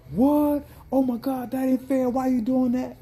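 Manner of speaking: high, loud whining customer voice; mock an indignant customer, pitched high; whiny customer. Vendor imitates customers upset he stopped serving, high whine. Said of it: "customers protest the closing".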